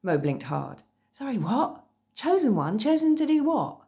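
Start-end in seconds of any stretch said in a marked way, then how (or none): 1.1-3.8 high-pitched, incredulous schoolgirl; baffled surprise. Acted. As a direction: question it in startled disbelief, pitch jumping up. Startled schoolgirl questions a vampire.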